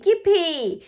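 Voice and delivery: high, loud cheer; excited shout. Acted. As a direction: cheer it out with glee. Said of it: "Peppa shouts".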